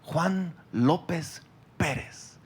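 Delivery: slow, hushed reading of a record, ending in a whisper; solemn reading. Acted. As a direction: read the name softly like a sacred registry entry. (lectura solemne, lenta y susurrada al final)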